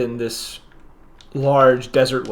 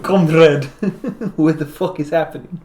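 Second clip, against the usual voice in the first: put-on higher-pitched comic Russian accent; hammed-up Russian comrade voice; 4 semitones higher, 4 dB louder than usual. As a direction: put on a jokey Russian accent, breaking into laughter. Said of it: "do my Russian accent".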